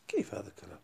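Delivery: whisper. Acted. Rhetorical aside to students.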